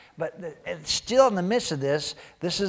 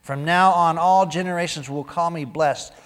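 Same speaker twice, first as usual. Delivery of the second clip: preached scripture; 3 semitones higher, 11 dB louder than usual. Acted. Reads Magnificat with full force.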